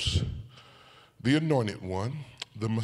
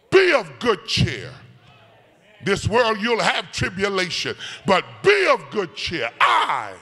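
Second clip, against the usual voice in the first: loud, high sung whoop of a preacher; climbing sung sermon whoop; preaching at full force; 6 semitones higher, 7 dB louder than usual. Acted. Climax of sermon.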